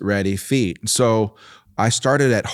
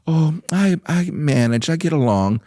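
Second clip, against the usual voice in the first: lightly voiced modest mom, starting higher; humble mother; 6 semitones higher than usual. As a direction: imitate mom's demure little reply. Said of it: "on her arm and humble".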